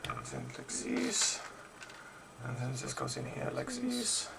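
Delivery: soft, slow, bad German accent. Builder narrating where the pieces go, bad German accent. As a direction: drawl softly in a mock German accent. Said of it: "suddenly adopted a German accent".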